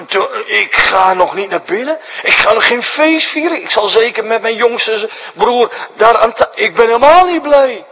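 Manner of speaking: put-on the older brother who won't come in to the feast; sulking refusal. Oldest son to his father, refusing the feast, building indignant.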